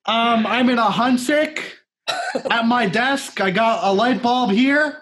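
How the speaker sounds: high-pitched impression of a quirky director; David Lynch. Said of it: "Hey, very Lynchian".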